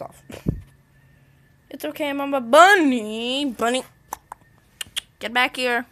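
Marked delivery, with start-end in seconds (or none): 1.7-5.8 loud, drawn-out call; calling a pet. Real, not acted. Owner to bunny off the bed, loud calling. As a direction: call out loudly after a runaway pet.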